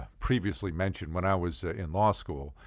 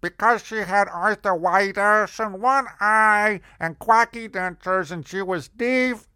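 put-on high, loud caricature of an old landlady; hammed-up deaf old lady with bad dentures; Mama Jerry; 11 semitones higher, 6 dB louder than usual. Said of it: "she talked like this".

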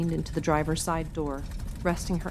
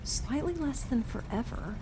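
higher, lighter mom voice; lilting higher-pitched reply; mother's voice; 8 semitones higher, 4 dB quieter than usual. A narrator's reading. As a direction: answer lightly, like a patient mom. Mother answers her impatient daughter.

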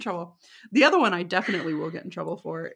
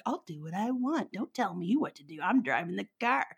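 sassy; 6 dB quieter than usual. Retold or imitated. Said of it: "like, sassy look".

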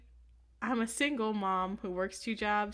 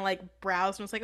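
ordinary delivery

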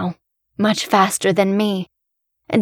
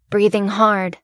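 usual speaking voice

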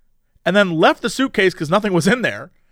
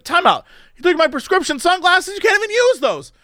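indignant; 11 semitones higher than usual. Retold or imitated. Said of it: "I was like".

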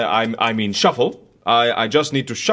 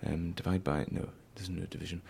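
much softer, half-whispered fumbling sums; muttering to himself; 8 semitones lower, 13 dB quieter, faster than usual. Acted. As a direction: lose yourself in muddled mental arithmetic. Mumbling calculations to himself.

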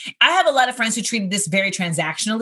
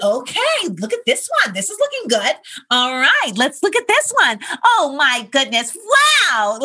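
very chipper nurse; 6 semitones higher, 3 dB louder than usual. Retold or imitated. Ultrasound nurse greeting patient Amanda at the scan, much higher and very chipper. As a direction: gush like an overly cheerful ultrasound nurse. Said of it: "like, very chipper".

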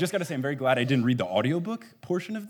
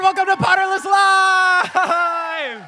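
high, huge hype-man yell, last word held long; show-opening shout; 8 dB louder, slower than usual. Real, not acted. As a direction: belt out the welcome to a cheering crowd. Opening a live show to crowd.

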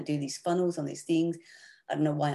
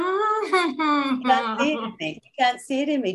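slow, high and loud, pressing the point; emphatic insistence; 10 semitones higher, 6 dB louder, slower than usual. Real, not acted. Guest insisting to the host, slow emphatic plea. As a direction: drive it home to her.

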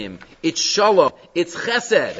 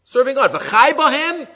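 higher, louder mock zealot; mock heretic; 8 semitones higher, 4 dB louder, slower than usual. Rabbi mimicking heretics who scorn V'chai b'hem, mock zealous. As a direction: scoff at the sages, play the zealot. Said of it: "that say".

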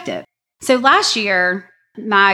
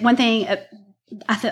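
ordinary delivery

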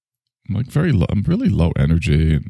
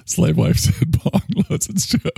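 laughing; 10 semitones higher than usual. Real, not acted.

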